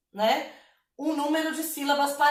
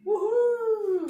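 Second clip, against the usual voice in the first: high, drawn-out "woo-hoo"; celebratory whoop; 9 semitones higher than usual. Real, not acted. Teacher cheering the finished verse, celebratory whoop. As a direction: let out a long high whoop.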